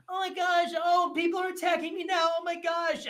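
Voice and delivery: high, loud mock shriek of panic; mocking e-girl. Streamer mocking e-girl crying that people attack her, high shrieking mock voice. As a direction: shriek like a drama queen under attack. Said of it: "And then they go".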